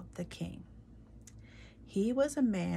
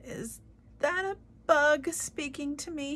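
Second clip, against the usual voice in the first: puzzled king; 8 semitones higher, 5 dB louder than usual. Acted. King squinting at the floor.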